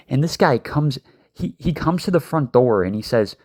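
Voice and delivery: ordinary talk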